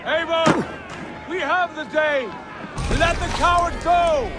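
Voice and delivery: a high call across the field. Acted. Brother calling Eivor off the fleeing coward, calling out. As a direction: shout to be heard over the fighting.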